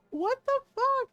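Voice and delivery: very high, loud disbelief; incredulous outburst. Retold or imitated. Streamer reacting to the absurd Prime timing, incredulous. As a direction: squawk it in mock outrage.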